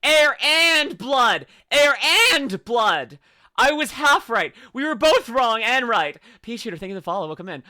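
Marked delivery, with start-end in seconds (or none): 0.0-3.2 loud, slow "Air and blood!"; loud drawn-out gloat; triumphant shout. Real, not acted. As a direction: belt it out slowly, gloating. Triumphant correction.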